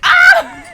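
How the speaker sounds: high, loud burst of a scream; sudden scream. Acted. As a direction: let out a quick shriek. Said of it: "or scream".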